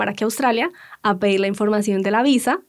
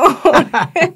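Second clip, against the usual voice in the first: higher, louder burst of laughter; 7 semitones higher, 7 dB louder than usual. Real, not acted. Wife laughing at husband's joke, high loud laugh. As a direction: let a real laugh burst out.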